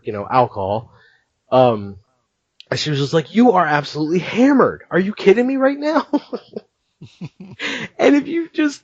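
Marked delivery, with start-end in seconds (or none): 3.3-8.8 mock scolding. Retold girl scolding drunk husband.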